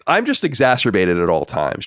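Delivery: ordinary delivery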